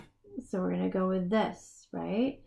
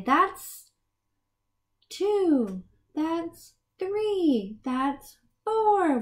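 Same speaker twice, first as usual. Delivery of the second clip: put-on child answering; 7 semitones higher, much slower than usual. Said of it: "just say".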